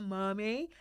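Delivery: a sweet little call of "Mummy."; gentle call. Retold or imitated. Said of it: "Whereas Tam is like".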